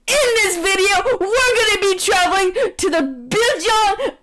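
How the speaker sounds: high hyped vlogger voice; YouTuber intro. Acted. A YouTuber announcing the trip, high and hyped. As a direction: hype it like a video intro.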